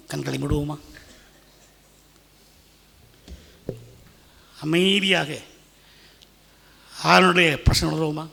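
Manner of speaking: gentle call to stillness; subdued, reverent invitation. Real, not acted. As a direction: lead the congregation calmly into prayer, with long pauses. Leads congregation into quiet prayer.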